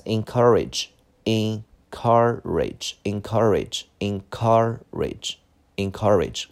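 slow teacher's model of "encourage", partly split up; syllable by syllable. Shown as a demonstration. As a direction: repeat "encourage" slowly, stretching each syllable. (逐个音节慢读encourage)

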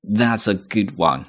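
slow, falling that's a good one; 慢慢赞赏; slow appreciative. Shown as a demonstration. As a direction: praise a clever joke, slowly. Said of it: "你就会说".

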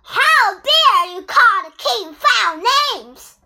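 slow, loud royal outrage; indignant roar. A narrator's reading. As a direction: thunder it down in wounded pride. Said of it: "King Bear roared".

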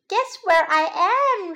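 little bunny's high, loud call; shouting. A narrator's reading. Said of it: "shouted little bunny".

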